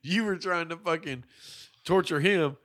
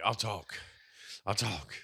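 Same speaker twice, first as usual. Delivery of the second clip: quiet resigned mutter; 5 semitones lower, 7 dB quieter, slower than usual. Retold or imitated. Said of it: "But Rambo's like".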